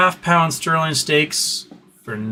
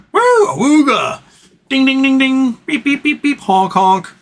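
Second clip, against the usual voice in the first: high, loud woo, ding, beep, honk; silly clown sound effects; 12 semitones higher, 4 dB louder than usual. Retold or imitated. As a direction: play a goofy noise box. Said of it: "a noise box like Jim Cramer".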